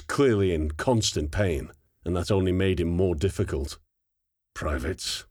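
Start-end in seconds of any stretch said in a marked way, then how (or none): 4.5-5.2 low, soft "Privates"; quiet menace, lower and softer; softly. A narrator's reading. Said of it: "the sergeant said softly".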